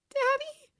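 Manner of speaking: high small voice asking; a tearful girl's hesitant question; little girl. A narrator's reading. Daughter recognising her father on phone, high and small.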